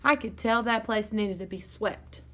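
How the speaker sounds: plain reading voice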